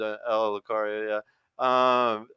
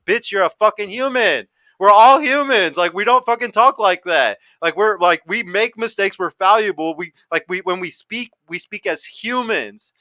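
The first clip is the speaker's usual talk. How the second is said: mock outburst; 7 semitones higher, 6 dB louder than usual. Mock retort to robot talkers.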